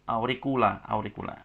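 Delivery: auricula with CU said as "ku"; correct K reading. Shown as a demonstration. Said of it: "Cu-nya dibaca seperti K".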